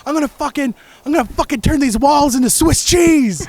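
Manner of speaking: threaten, half-acting his rage; imitating mad chef. Roommate quotes furious chef, mild mock rant. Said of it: "'cause he was so mad".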